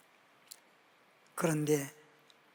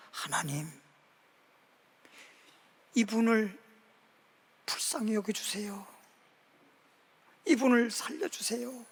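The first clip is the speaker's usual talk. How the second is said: hushed prayer; 4 dB quieter than usual. Retold or imitated. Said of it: "손을 잡고 기도를 해주는 거예요".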